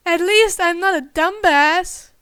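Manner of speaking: loud sibling comeback; snappy retort. A narrator's reading. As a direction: fire back at him.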